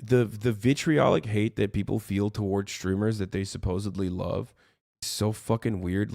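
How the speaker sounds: normal talking voice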